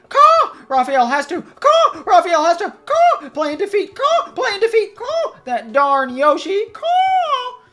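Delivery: words punctuated by very high, loud caws; squawking raven. Acted. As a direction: play a cawing raven villain, squawking between phrases. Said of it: "read some of the character bios".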